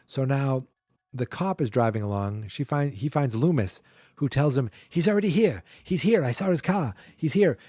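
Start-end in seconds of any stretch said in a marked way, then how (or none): 4.9-7.6 higher, insistent mock alarm; imitated urgent warning. Doctor warning a cop the killer is here, imitated urgent raised voice. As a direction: mimic a doctor frantically alerting police.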